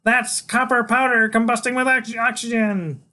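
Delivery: high, excited scream; shrill excited kid explaining at a shout; Freak. A narrator's reading. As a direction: yell it loud and high like a thrilled kid. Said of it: "Freak tugs in my hair and screams".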